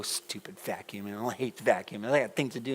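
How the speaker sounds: mock a kid muttering while vacuuming; grumbling under breath.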